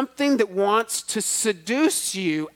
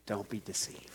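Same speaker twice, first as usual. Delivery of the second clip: hushed whispered warning; 11 semitones lower, 15 dB quieter than usual. Acted. Preacher quoting Paul to the congregation, whispered. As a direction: breathe the words like a secret.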